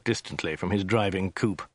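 usual speaking voice

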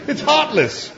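very high, aghast verdict; indignant protest. A narrator's reading. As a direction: cry it out pained, pitch soaring then falling. Arthur's indignant objection in the story.

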